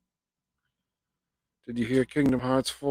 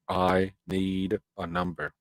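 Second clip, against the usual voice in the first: "I need a number" spaced out slowly; measured, emphatic restatement of "I need a number"; slow, deliberate insistence; slower than usual. Real, not acted. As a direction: spell out "I need a number" word by word. Slow emphatic repeat of the demand.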